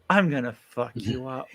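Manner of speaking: quiet, smiling threat; smiling menace. Shown as a demonstration. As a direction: say it sweetly but menacing. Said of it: "if you wanna smile when you say it, you go".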